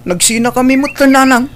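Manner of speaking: can't believe what he just heard; stunned son asking his mother; shocked question. Acted.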